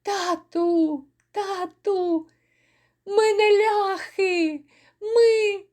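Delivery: put-on high, crying child pleading; a child sobbing out to its father; babbling crying child. A narrator's reading. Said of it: "белькотали".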